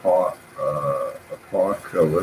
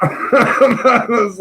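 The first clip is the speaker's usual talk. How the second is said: high laughing burst; 10 semitones higher, much slower than usual. Retold or imitated. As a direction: laugh it out in disbelief.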